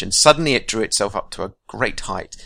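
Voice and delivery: ordinary talk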